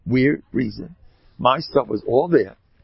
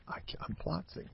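whispered plotting; 6 semitones higher, 16 dB quieter than usual. Retold or imitated. Inner voice of the resentful man.

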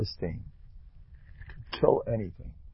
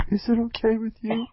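doting mother; 12 semitones higher than usual. Retold or imitated. Mother asking her little child about shoes, high coaxing. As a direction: coo at the child like a pushy-sweet mom. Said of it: "the mother bends down".

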